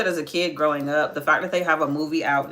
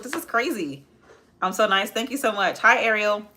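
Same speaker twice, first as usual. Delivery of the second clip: ordinary delivery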